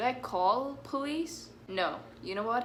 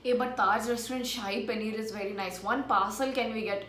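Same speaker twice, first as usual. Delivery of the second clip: ordinary talk, nothing marked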